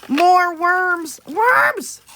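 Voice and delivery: shouting happily, pitch leaping up; excited shout. Real, not acted. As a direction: yell it out thrilled. Excited about spooky worms.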